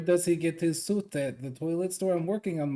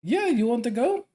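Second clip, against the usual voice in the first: baiting invitation; 9 semitones higher, 8 dB louder than usual. Acted.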